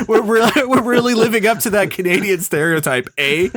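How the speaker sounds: laughing through the words; chuckling while talking. Real, not acted. Joking about Canadian stereotype.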